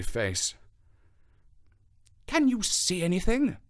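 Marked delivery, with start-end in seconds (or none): 2.3-3.6 higher-pitched, tense question; anxious detective voice, pitched high; Holmes, anxious and urgent. Acted. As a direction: ask it keyed up, straining for an answer. Holmes searches the dark moor urgently.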